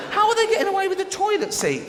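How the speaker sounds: high-pitched comic indignation; incredulous outrage. Acted. Incredulous rant about stolen seats.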